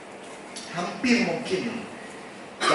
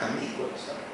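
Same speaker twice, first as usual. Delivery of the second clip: ordinary delivery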